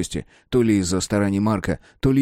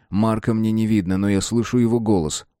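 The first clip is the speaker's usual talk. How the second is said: plain reading voice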